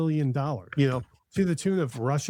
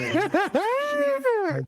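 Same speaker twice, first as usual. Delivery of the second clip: high squealing laugh through the words; laughing shout; 4 dB louder, much slower than usual. Real, not acted. Squealing with laughter to his co-hosts. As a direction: burst out laughing as you ask.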